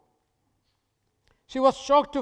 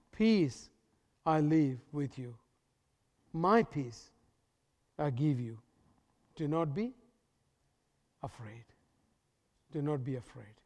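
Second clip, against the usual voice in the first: soft reassuring scripture; 6 semitones lower, 7 dB quieter than usual. Acted. Preacher quoting Jesus's promise of peace, hushed and low. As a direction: lay each word down gently. Jesus's words quoted softly.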